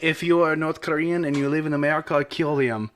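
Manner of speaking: a light put-on Slavic accent as Kim Jong-un; lightly voiced Slavic accent, deadpan order. Friend mocking Kim Jong-un in a light Slavic accent. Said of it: "I don't know why I did a Slavic accent".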